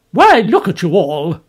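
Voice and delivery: bawled. A narrator's reading. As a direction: bellow at the gaping servants. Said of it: "bawled the figure".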